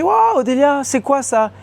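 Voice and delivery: high, exaggerated picture-book voice; gushing mother. Speech-therapist mother showing a picture book, high-pitched encouragement. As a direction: coo at her as if at a toddler. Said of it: "elle avait dit comme ça".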